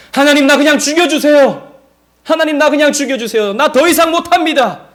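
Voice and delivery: high, loud cry of despair; imitating a prophet's anguished outburst; acted desperate plea. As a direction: plead to God at the end of your rope. Acting Elijah's despairing plea to God.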